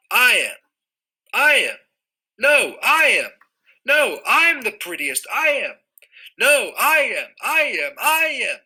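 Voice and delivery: cats all shouting over each other; squabbling cats crying. Acted. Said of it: "cried hundreds and thousands".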